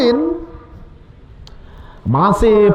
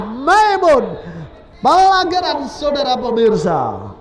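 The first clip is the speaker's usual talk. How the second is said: normal talking voice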